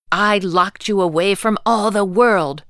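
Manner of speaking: loud furious witch outburst; angry witch cry. A narrator's reading. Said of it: "the witch cried".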